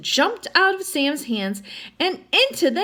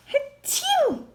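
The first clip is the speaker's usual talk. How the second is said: tiny high squeaky sneeze; hamster sneeze. A narrator's reading. Narrator voicing the hamster's tiny sneeze.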